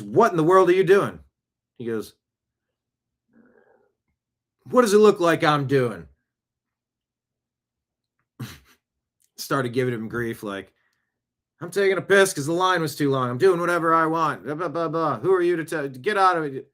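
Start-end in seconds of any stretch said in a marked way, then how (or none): 0.0-1.2 higher, loud outraged demand; retold bouncer shout. Bouncer finds a man peeing in the sink, loud, high outrage. 4.6-6.1 higher, louder sarcastic retort; mocking comeback. Man in the sink mouths off to the bouncer, louder sarcastic retort. Drunk guy sasses the bouncer. 11.6-16.7 higher, whiny, dismissive rant; mock brat voice. Man giving the bouncer grief, higher whiny rant.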